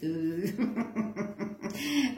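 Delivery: low, soft chuckle; laughing. Real, not acted. Tarot reader amused after singing to the cards, soft laughing. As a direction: chuckle quietly at your own singing.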